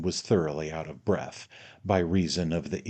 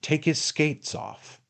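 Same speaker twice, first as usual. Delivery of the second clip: stern order, pitched higher; 7 semitones higher than usual. A narrator's reading. Indignant master orders skates removed, stern. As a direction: give a stern, indignant command. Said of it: "said in a stern voice".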